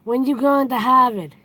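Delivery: dropped-r Boston accent on Harvard, said as hahvid. Shown as a demonstration. Showing viewers a Boston accent. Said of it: "I'll say it with the Boston accent".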